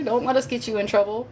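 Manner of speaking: ordinary talk, nothing marked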